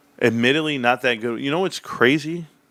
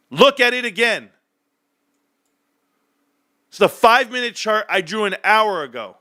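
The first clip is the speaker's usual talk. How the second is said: high, raised demands to look; heated rant; 8 semitones higher than usual. Real, not acted. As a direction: push it in the viewer's face.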